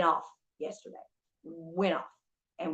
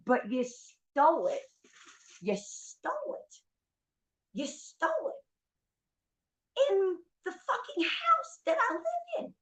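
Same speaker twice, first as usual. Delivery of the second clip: exasperated accusation; 12 semitones higher than usual. Retold or imitated. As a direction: stretch each word, climbing much higher in outrage. Acted confrontation with the thief.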